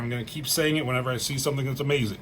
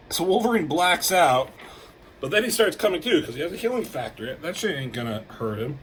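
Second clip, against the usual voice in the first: normal talking voice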